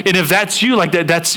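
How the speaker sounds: ordinary delivery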